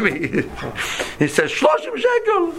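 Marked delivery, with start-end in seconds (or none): 1.5-2.5 high, drawn-out indignant taxi driver; mimicking driver. Imitating the upset cabbie as he snaps the fare back. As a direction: squeal the price like the offended driver.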